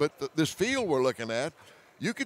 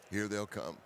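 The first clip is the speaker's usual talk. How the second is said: much softer, almost whispered; hushed repeat; 8 semitones lower, 11 dB quieter than usual. Real, not acted. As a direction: say it quietly as if deer are near. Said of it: "repeated much softer".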